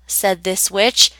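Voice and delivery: plain reading voice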